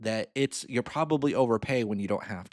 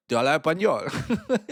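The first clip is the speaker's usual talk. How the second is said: native Spanish; 5 semitones higher, 4 dB louder than usual. Retold or imitated. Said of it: "I was like".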